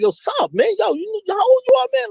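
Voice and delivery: high, mocking question; high teasing voice; teasing friend. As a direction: rib an old man acting young. Friends pull his coat.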